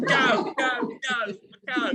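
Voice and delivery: high "go, go" pushed away; mock shooing. Himself thinking at the stranger, mock shooing. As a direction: playfully mimic wanting him gone. Angry inner wish to shoo away.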